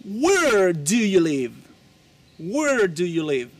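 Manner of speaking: loud, slow teacher repetition of Where do you live; slow model question. Shown as a demonstration. Teacher drilling students on where questions. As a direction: spell it out slowly and clearly. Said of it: "Porque estás haciendo la pregunta".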